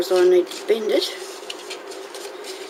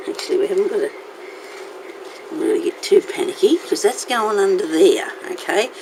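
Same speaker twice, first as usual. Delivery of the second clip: usual speaking voice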